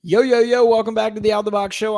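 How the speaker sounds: loud, high podcast host opener; show intro hype. Acted. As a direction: kick off the show big and bright.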